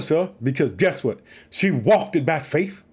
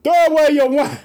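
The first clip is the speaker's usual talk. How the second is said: higher, louder mock rebuke of Eli; stern priest's scolding; 9 semitones higher, 6 dB louder than usual. Preacher acting out Eli scolding the praying woman, hammed-up rebuke. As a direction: bark it out like a stern old priest.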